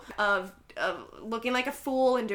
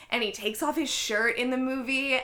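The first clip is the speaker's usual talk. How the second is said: ordinary talk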